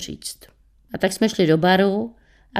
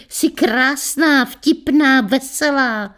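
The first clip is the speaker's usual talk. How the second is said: higher, slow cheerful call of friends; 6 semitones higher, slower than usual. A narrator's reading. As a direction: sing out the praise together. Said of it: "volali jsme my kamarádky".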